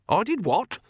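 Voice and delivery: high, sharp startled question. A narrator's reading. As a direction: caught off guard, demand it. Granny startled.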